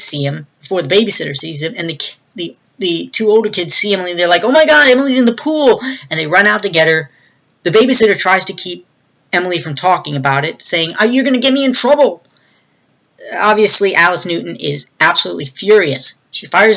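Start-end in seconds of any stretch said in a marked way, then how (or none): 4.4-5.8 high, louder mock outcry; alarmed exclamation. Kids crying out that Emily's in the pool, mock alarm. 11.0-12.2 high, quick imitation of a worried babysitter; mock scolding. Babysitter hushing the child over trouble, high mock scolding.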